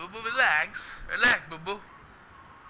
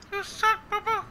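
much higher, put-on cartoon bear voice; Yogi Bear voice; 13 semitones higher than usual. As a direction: tease him like a cartoon bear. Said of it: "Yogi Bear told you s".

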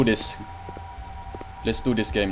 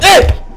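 short high, loud shriek; real jump-scare squeal; startled yelp; 13 dB louder than usual. Real, not acted. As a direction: cry out in sudden fright. Startled by game.

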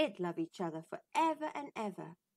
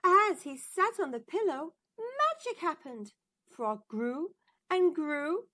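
wondering narration; 6 semitones higher, 5 dB louder than usual. A narrator's reading. Magic moment in the story.

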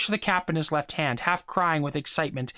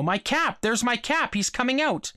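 higher, eager cries; boy spotting his stolen cap, higher and eager; excited boy; 7 semitones higher than usual. A narrator's reading. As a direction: point and call out, lightly excited. Boy spots his lost cap.

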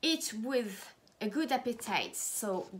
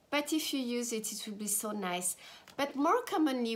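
ordinary talk, nothing marked

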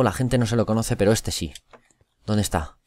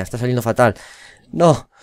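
ordinary delivery

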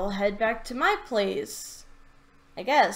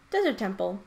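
usual speaking voice

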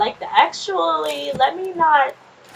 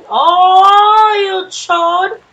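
loud insult; 8 semitones higher, 10 dB louder, much slower than usual. Real, not acted. Insulting an enemy in game.

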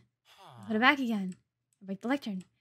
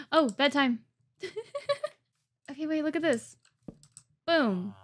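ordinary talk, nothing marked

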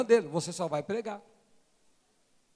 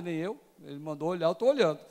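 normal talking voice

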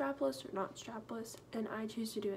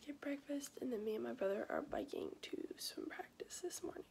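whispering; 3 semitones higher, 5 dB quieter than usual. Real, not acted. Late night, family asleep.

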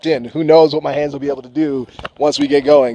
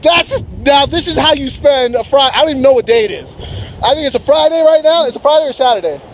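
higher and louder, breathless delight; real thrill; 11 semitones higher, 3 dB louder than usual. Real, not acted. Paraglider showing viewers the mountains, giddy and loud. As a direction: gush like you can't believe the view.

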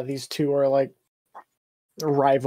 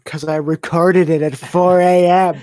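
louder, defensive retort, slightly higher; mock exasperated reply; 4 semitones higher, 12 dB louder than usual. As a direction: snap back the excuse with exaggeration. Answering mock viewer question.

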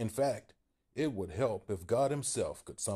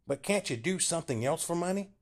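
small man pushing hard; timid Paul insisting; 9 semitones higher than usual. A narrator's reading. Paul questioning Bill.

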